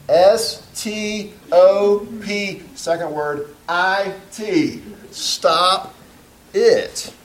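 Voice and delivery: slow, high-pitched letter by letter; exasperated spelling-out. Acted. Counselor spelling his advice to a confused client, exasperated. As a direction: spell it out slowly for someone who won't get it.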